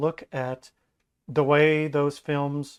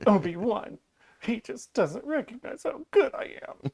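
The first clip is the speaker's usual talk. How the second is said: high-pitched mock petulant whine; whiny baby; 7 semitones higher than usual. Imitating Anakin complaining about Obi-Wan, high whiny voice. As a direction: complain like a sulky child. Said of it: "I want you to sound like a whiny baby".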